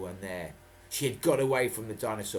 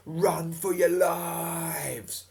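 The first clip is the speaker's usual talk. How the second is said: slow, high, louder drawn-out yell; shouting; 8 semitones higher, 6 dB louder, much slower than usual. Acted. Captain calls from the wall top to children, long yell. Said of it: "He shouted at Scott and Jess".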